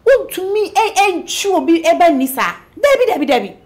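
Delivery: loud, high-pitched protest; heated objection. Acted. Friend objecting to what Dave has done, heated. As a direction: refuse it outright with rising heat.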